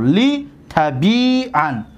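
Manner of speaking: لیتبیعن drawn out slowly; slow correct model. Shown as a demonstration. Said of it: "درستش این است". (لیتبیعن بسیار آهسته)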